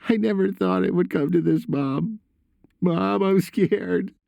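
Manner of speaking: put-on high, frightened boy's voice; scared son. A narrator's reading. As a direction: plead to Mom in a high voice, slowing on the fear.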